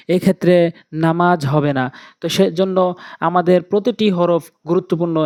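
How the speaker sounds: ordinary talk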